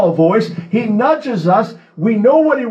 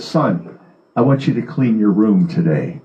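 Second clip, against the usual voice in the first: mother's voice dropped lower, mild and patient; a lightly voiced mother asking her son nicely; gentle mother asking; 13 semitones lower than usual. Mother gently asking her son to clean his room, lower and patient. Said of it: "As mother might say".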